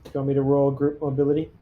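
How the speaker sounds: ordinary delivery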